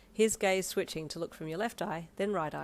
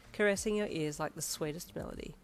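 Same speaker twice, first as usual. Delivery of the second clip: ordinary delivery, nothing marked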